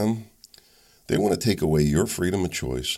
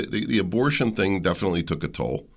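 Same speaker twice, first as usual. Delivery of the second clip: usual speaking voice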